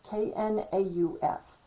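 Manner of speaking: normal talking voice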